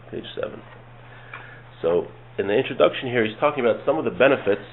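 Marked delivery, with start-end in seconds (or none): 0.1-0.6 repeated under the breath in a whisper; whispered aside. Real, not acted. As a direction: whisper the page number to yourself. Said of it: "we're on page seven".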